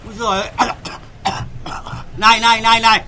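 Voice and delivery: high, loud grumbling dad; grumpy father. Acted. Father grumbles at the girl shouting outside, high and loud. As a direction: fuss about the noise outside.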